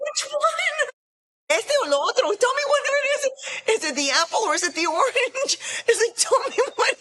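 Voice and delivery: high-pitched, laughing incredulity; exasperated demand. Retold or imitated. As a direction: comically plead for a straight answer. Retold demand for a clear answer.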